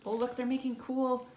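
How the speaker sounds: usual speaking voice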